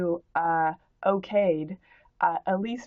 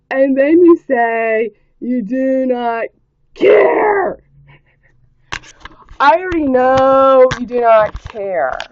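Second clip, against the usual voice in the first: rant; 5 semitones higher, 10 dB louder than usual. Real, not acted. Ranting at commenter.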